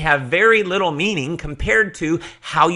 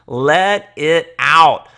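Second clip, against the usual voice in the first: louder, drawn out slowly; let it out; 7 dB louder, much slower than usual. Shown as a demonstration. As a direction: really release it on the words. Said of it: "Stop holding back your excitement".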